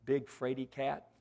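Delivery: put-on small, quiet, timid child's question; a hint of a frightened little girl, hushed; shaky little voice. Granddaughter in bed during a thunderstorm, timidly asking. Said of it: "granddaughter's shaky little voice".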